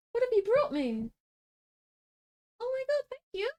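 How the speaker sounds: delighted high squeal; thrilled by a surprise gift; excited. Real, not acted. Dad brings her snacks.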